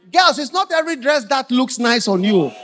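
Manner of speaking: loud high shouting sermon; full-force preaching. Acted. Preacher warning the girls about dresses, shouting. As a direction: preach it hard at the girls, slowing at the end.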